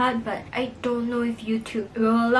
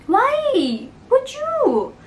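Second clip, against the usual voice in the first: high, slow disbelief; ongelovig; 5 dB louder, much slower than usual. Real, not acted. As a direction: stretch out the disbelief, much higher. Mocking husband with ketchup.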